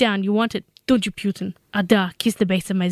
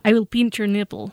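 Russian-accented line on request; put-on Russian English. Shown as a demonstration. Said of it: "pinch in a Russian accent".

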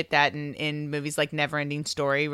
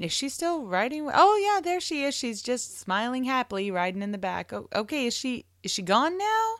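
exaggerated double-takes, voice swooping up high; puzzled viewer; 8 semitones higher than usual. Viewer acting out confusion over the vanishing girl, mock puzzled. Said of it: "I kept thinking".

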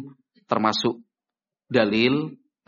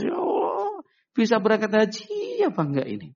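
tearful wail; 9 semitones higher than usual. Acted. Would-be pilgrim going home after registering, wailing. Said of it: "pulang menangis".

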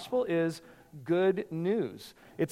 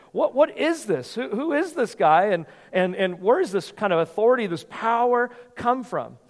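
amazed crowd; 5 semitones higher, 4 dB louder than usual. Retold or imitated. Crowd in the synagogue asking about Jesus, astonished and higher. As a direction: voice the stunned crowd wondering who he is.

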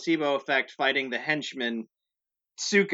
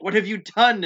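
raised, louder mock outrage; acted alarmed demand; 7 semitones higher, 6 dB louder than usual. Mister Shoji finds them, acted dismay. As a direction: confront them in character, pitched up. Character confronts the soup cook.

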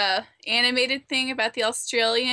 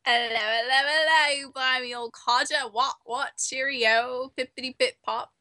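hammed-up British gibberish accent. Answering the challenge to a British off, mock British accent. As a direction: spout silly stock British phrases. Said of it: "let's have a British off right now".

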